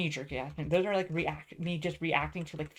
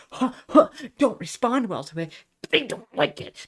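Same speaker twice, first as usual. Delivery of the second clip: louder burst with higher jumps; exasperated outburst; 6 semitones higher, 9 dB louder than usual. Retold or imitated. Complaining that friends get pissed off, loud exasperated outburst. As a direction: let the frustration burst out mid-rant. Heated rant, mimics not responding well.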